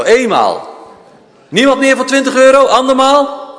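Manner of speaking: loud, high auction call; auctioneer. Real, not acted. Auction call going once, twice. (luide veilingmeester)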